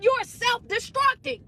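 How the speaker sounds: high, slow, drawn-out cry; emphatic exhortation. Real, not acted. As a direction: let the pitch soar to drive the point home. Climax of exhorting the listeners.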